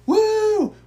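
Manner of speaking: high, loud yelping woo; mock an obnoxious fan whooping; shrill-free hammed-up fan hoot; imitating a fan's woo. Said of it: "he starts going".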